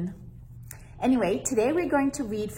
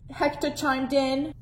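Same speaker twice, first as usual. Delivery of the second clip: ordinary delivery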